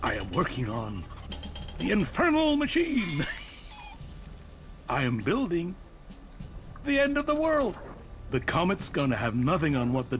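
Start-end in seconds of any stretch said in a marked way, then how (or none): 1.8-3.2 very high, theatrical madman's cry; maniacal proclamation. Acted. Bomb maker unveiling his work to a visitor, maniacal and high-pitched. 3.3-4.3 soft, very high cackle; mad giggle. Acted. Bomb maker delighted by his machine, high mad giggle. 6.8-7.8 high-pitched crazed glee; maniacal proclamation. Acted. Bomb maker telling a visitor of doomsday, maniacal and very high.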